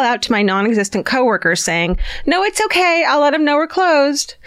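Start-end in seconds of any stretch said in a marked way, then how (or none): 2.2-4.3 casual pretend call-out. Pretends to answer absent coworkers.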